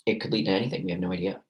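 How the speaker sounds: normal talking voice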